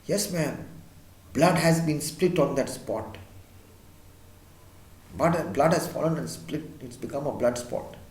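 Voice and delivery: low, soft, grave housekeeper. A narrator's reading. Housekeeper quietly tells Missus Otis about the stain, low voice. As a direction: keep it low, as if telling a dark secret. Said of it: "says in a very low voice".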